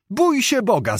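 a high, urgent exclamation; alarmed cry. Acted. Maćko crying out at his nephew's boasting. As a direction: cry out in dismay. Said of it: "zawołał Maćko".

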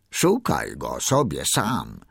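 low, slow dismissive walrus; lumbering, sleepy low reply. A narrator's reading. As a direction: brush him off slowly and low. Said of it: "odparł koń morski".

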